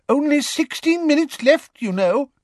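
put-on high fretful old voice; anxious. A narrator's reading. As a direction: worry about the clock. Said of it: "said Professor Tofty anxiously".

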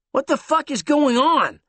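high, loud, shocked question; alarmed. Acted. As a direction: blurt out in alarm. Tom alarmed by news.

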